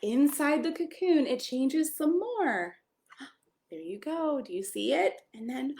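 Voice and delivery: gentle picture-book read-aloud; storybook voice for a small child. Acted. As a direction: read it like a bedtime story to a little one.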